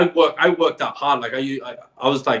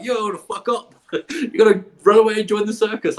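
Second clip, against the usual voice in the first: higher, laughing mockery of nagging parents; hammed-up scolding parent; mocking parents; 8 semitones higher than usual. Parents scolding him for leaving, hammed-up mockery. Parents argue before he leaves.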